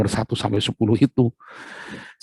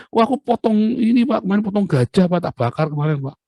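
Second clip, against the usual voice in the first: overeager devotee, pitched way up and pleased with himself; bragging worshipper; 10 semitones higher than usual. Acted. Worshipper brags to God about slaughtering an elephant, high and eager.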